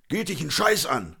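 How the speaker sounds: acted cantankerous snap; worked-up retort. A narrator's reading. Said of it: "Conny kam jetzt richtig in Fahrt".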